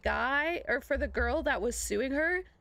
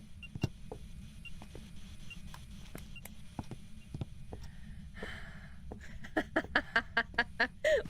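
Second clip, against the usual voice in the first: long silent wheeze laugh; 4 semitones higher, 8 dB quieter than usual. Real, not acted. Laughing at own mistake.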